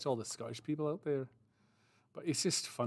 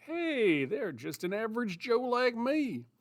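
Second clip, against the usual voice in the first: bright, much higher average Joe voice; everyman voice; 9 semitones higher, slower than usual. Retold or imitated. Streamer voicing a relatable character, much higher. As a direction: pitch it way up like a cheerful regular guy.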